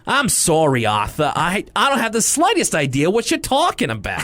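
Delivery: put-on thick Boston accent. Host imitates Missus McGrady counseling Arthur, mild Boston accent. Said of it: "her accent really thick here".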